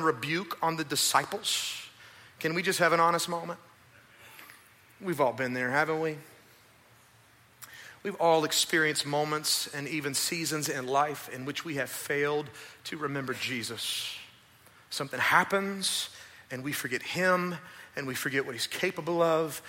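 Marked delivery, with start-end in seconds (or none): none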